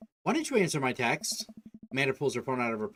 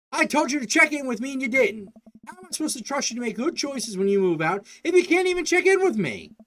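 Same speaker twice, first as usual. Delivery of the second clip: higher and louder, a raised parental scold; angry scolding; 11 semitones higher, 5 dB louder than usual. Acted. Dad yelling back at his daughter, angry. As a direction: lay down the law loudly.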